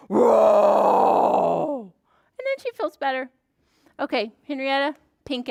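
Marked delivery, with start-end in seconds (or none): 0.1-1.9 loud, low fire-breathing roar; angry dragon roar. Pretend. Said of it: "has to breathe fire when she's mad".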